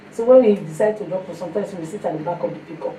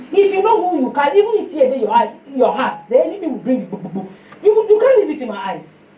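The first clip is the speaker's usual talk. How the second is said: defiant retort; 4 semitones higher, 5 dB louder than usual. Retold or imitated. Defiant reply in retold exchange.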